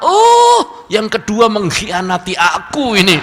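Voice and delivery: a high, loud wailing "Oh" from the jealous first wife; betrayed wife. Acted. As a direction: cry out shrill and high as a wife who feels betrayed.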